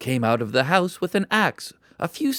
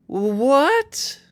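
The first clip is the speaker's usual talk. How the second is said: surprised; 12 semitones higher, 4 dB louder than usual. A narrator's reading. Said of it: "he thought".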